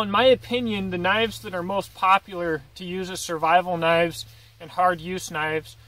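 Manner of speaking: usual speaking voice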